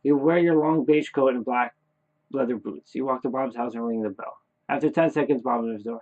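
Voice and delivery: ordinary delivery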